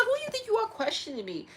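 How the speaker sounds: high, indignant scolding of a son; mock indignant scolding. Retold scolding, pitch up.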